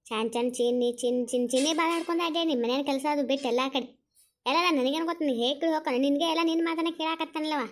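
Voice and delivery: loud, heated telling-off; angry scolding. Acted. Elder berating a younger one over the pet name "Chinni", loud angry scolding. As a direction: scold loudly without letting up. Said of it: "ಯಾಕೆ ಈ ಪರಿ rice ಆಗ್ತಿದ್ರಾ".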